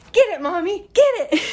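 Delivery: high, gleeful imitation of a three-year-old; cheering toddler. Mother imitating her toddler cheering on the snake kill, high and gleeful. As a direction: egg her on like a thrilled toddler. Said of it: "my three-year-old is like".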